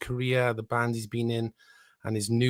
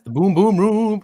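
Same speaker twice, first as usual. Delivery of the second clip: higher, louder, punchy movie line; hyped movie quote; 8 semitones higher, 8 dB louder than usual. Retold or imitated. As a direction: belt it like a film catchphrase. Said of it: "The Eddie Murphy film".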